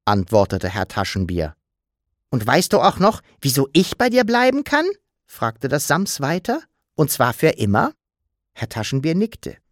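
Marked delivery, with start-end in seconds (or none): 2.3-7.9 put-on lightly higher cheeky child creature, cheerful; playful little creature voice; the Sams. Sams wishes Papa happy birthday, cheeky.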